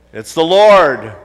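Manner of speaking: booming voice. Retold or imitated. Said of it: "A booming voice came back".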